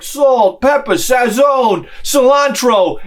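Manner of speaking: loud, slow listing of spices; mock Spanish cook. Spanish in-laws listing burger spices, loud and proud. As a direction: proudly call out each spice. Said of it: "they're like".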